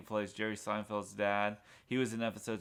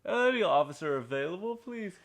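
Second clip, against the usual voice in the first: mock police dispatcher; 5 semitones higher, 4 dB louder than usual. Police radio call retold.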